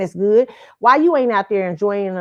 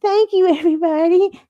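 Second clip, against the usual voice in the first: mock gracious star; 9 semitones higher, 3 dB louder than usual. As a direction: parody a celebrity's fake gratitude on stage. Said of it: "and you're like".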